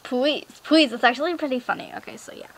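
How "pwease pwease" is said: mock begging, PLEASE with W for L; loud cutesy "pweeze" for PLEASE; imitated begging pwease. Imitating begging anime character, PLEASE said as "pwease". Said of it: "begging to go do something".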